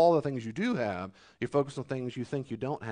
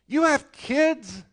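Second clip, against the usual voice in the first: much higher, slow incredulous question; incredulous rebuke; 12 semitones higher, 8 dB louder, much slower than usual. Acted. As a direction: stretch it out in mock disbelief. Preacher rebukes the complainers.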